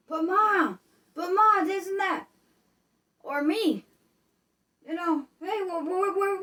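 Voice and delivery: high, loud and frantic, calling for Ma in the dark; a scared grown kid yelling through the house when the power dies; high loud panic, a sob breaking into the candle question; panicked family member. Acted. Acted panicking family member continues.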